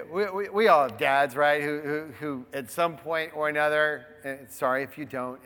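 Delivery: normal talking voice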